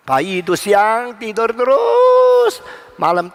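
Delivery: slow, building to a long held last word; drawn-out mocking exaggeration. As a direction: stretch it slowly, rising and swelling. Contrasting baby's day and night.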